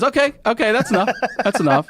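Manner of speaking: high, hurried-in cutoff, lightly acted; imitated interrupting judge. Judge stopping the defendant's song, high mock interruption.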